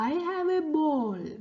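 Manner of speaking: a hint of a little elephant talking; baby elephant voice. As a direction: read it as a small cheerful baby elephant. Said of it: "Moti baby elephant പറയാണ്".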